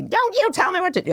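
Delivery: high hammed-up mock scold; mock yell. Mom yelling back at her son, mock outrage. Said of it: "I'm welcome to yell at you and say".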